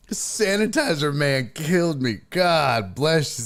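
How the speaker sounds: normal talking voice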